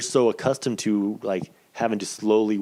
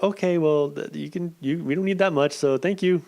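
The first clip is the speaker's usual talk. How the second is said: higher, sing-song nurse voice; a light higher-pitched take on the nurse; imitating the nurse; 7 semitones higher than usual. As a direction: mimic a polite, slightly awkward nurse. Nurse voiced in retold story.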